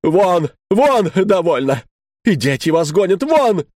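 wife screaming husband out of house; shouting. Retold or imitated. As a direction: drive him out with furious cries. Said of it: "А жена".

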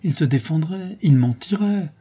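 crying out. A narrator's reading. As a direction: exclaim it, rising with indignation. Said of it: "s'écria madame Gresloup".

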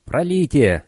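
high, creaky monster call; creaky inhuman voice. Acted. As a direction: croak it out like a beast. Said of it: "скрипучий нечеловеческий голос".

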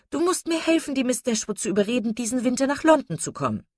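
high-pitched, eager pleading; effusive chatterbox lady. Acted. Wife asking her husband to help persuade the ladies, high and gushing. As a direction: beg excitedly in a high voice.

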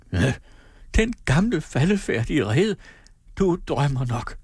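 high, scornful mother, slowing at the end; mother mocking her daughter's dream; cry out. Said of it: "råbte hendes mor". (moderen råber hånligt med høj stemme)

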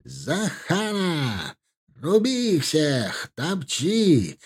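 high, drawn-out war cry; rally riders into the charge. A narrator's reading. Cavalry commander rousing his warriors, rallying cry. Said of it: "командир призывно закричал".

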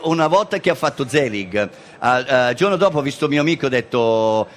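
usual speaking voice

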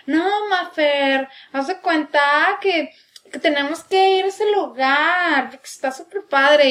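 slow, lightly mocked posh chilango voice; fresa chilango accent. Chilango fresas talking at the ice cream shop, slow mock. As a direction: stretch it like a posh Mexico City girl. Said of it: "es como que".

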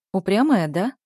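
usual speaking voice

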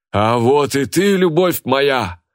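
high, drawn-out theatrical holler; loud bark. A narrator's reading. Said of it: "гаркнула Лика". (громкий протяжный окрик)

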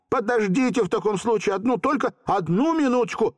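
put-on high, quick delighted boy; joyful exclamation. A narrator's reading. Volka asking the genie to wait, joyful. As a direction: blurt it out overjoyed. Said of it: "обрадованно воскликнул Волька".

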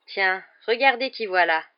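loud, slow mocking drawl; drawling sneer. A narrator's reading. Malfoy taunting from the compartment door, drawling. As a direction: drag the words out to taunt. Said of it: "de son habituelle voix traînante".

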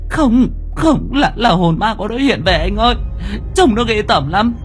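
very high, panicked pleading woman; frantic insistence. A narrator's reading. Girlfriend begs her boyfriend to rescue her from a ghost, frantically. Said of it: "Giọng của My khẳng định chắc nịch".

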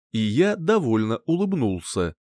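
plain reading voice

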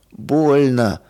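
ordinary delivery, nothing marked

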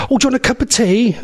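high-pitched, eager offer from a nan; doting nanny. Retold or imitated. Nanny greeting visiting grandchild, high eager nan voice.

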